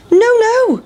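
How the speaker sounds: very high, sing-out refusal; crying out, defiant pancake. A narrator's reading. Pancake to farmer, crying out defiantly. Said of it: "cried the big pancake".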